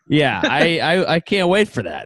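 ordinary talk, nothing marked

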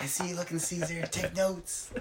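very soft, teasing undertone; hushed mock aside. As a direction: keep your voice down, teasing an onlooker. Continues the whispered mock aside.

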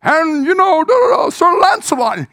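high, loud mock fanfare call; fanfare announcement. Preacher calling out the chosen actor like a herald, loud and high. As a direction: trumpet it out like a herald.

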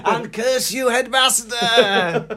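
high, drawn-out mock cry to the heavens; melodramatic curse. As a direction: echo a theatrical curse, stretching the last word. Joining in the melodramatic cursing.

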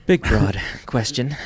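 loud, slow, amused repeat of big, broad; laughing echo. Real, not acted. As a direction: savour the words through a laugh. Echoing guest's words, laughing.

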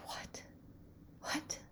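very soft, stunned whisper; under the breath, incredulous; hushed disbelief. Retold or imitated. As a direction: barely voice it, in shock. Finding locked door despite appointment.

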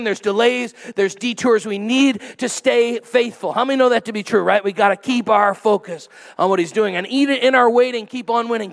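rousing call to stay faithful; preaching at full force. Real, not acted. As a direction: drive the point home to the congregation.

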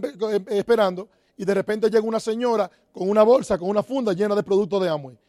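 ordinary talk

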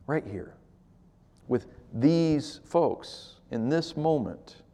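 soft, slow, hushed reply; quiet sincere answer. Real, not acted. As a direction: let it settle quietly, sincerely. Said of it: "quiet answer to the question".

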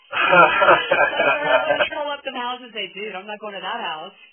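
loud, slow mock snake talk; a snake's pretend thoughts, hammed up; playful snake voice. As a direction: play a spooked rattler deciding to stay away. Voices snakes scared off by carcass.

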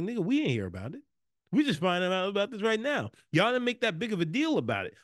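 rising, animated disbelief; mock indignation. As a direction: protest as if left out of the news. Acted indignant reply to carolers.

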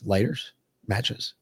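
slow, soft mock deadpan; imitating a grim agent, understated; ominous answer. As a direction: let the words drop quietly and slowly.

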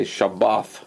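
"Shabbath" ending in a th sound; soft taf as th. Shown as a demonstration. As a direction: slowly finish "Shabbath" on th, not t. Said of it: "don't make a th sound".

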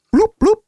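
silly bloop noise. Pretend. Silly sound effect after drip.

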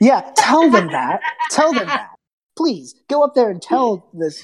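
laughing, high mock egging on. Real, not acted. GM laughing, urging players to tell the hag. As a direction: egg them on through laughter. Mocking encouragement amid laughter.

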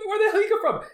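squeaky startled question; high mock surprise. Friend retelling the owner's entrance, high and startled. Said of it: "I was like".

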